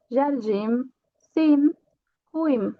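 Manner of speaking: jardim, sim and ruim ending in a nasal in. Shown as a demonstration. Teacher modeling the nasal final in for students. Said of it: "só vai ser in".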